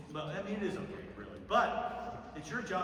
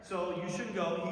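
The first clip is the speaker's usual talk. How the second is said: ordinary delivery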